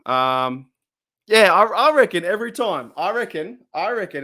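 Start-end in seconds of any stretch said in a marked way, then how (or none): 1.3-4.2 raised pitch, worked-up and insistent; fired up. Real, not acted. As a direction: push it out while still fired up. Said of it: "I'm pretty fired up".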